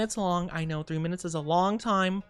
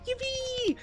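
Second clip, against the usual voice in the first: a squeaky high yippee; excited cheer; 5 dB quieter than usual. Real, not acted. Cheering about gifts.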